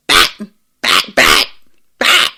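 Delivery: loud, abrupt non-dog barking; odd fake bark. Mocking the person voicing the dog, weird loud fake barks. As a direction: imitate a bad dog voice actor, each bark punched out. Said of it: "the person who's voicing this dog".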